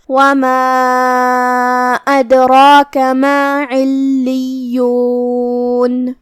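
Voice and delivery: slow, drawn-out melodic Quran chant; full tilawat with long held notes; tajweed recitation. Acted. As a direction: intone the whole passage like a reciter. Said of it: "تلاوت تجوید کے ساتھ سماعت فرمائیں".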